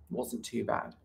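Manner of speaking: usual speaking voice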